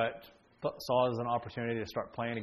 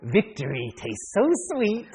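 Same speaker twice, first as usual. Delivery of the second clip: slow, loud, hammed-up gloat; gloating child victor; 7 semitones higher, 5 dB louder, slower than usual. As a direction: savor the win in mock triumph, pitched up. Voicing son gloating over his win.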